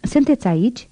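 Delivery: a high, urgent call down into the grave. Acted. Old gravedigger calls into the coffin, high and urgent. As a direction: cry out to the man in the coffin. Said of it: "și strigă".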